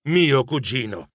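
usual speaking voice

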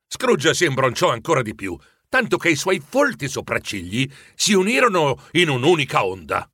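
usual speaking voice

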